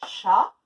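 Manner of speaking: chat, loud and on its own; syllable called out. Shown as a demonstration. Teacher to pupils, calling out the first syllable. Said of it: "on écoute".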